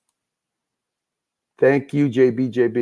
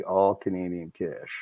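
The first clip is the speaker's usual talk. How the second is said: softer, lower repeat under the breath; quiet echo; 4 semitones lower, 9 dB quieter than usual. Pretend. Host echoing his own hype line to himself, quiet and low. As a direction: mutter it back to yourself.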